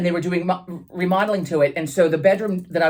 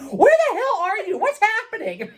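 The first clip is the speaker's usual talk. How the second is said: alarmed dad; 13 semitones higher than usual. Retold or imitated. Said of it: "He's like".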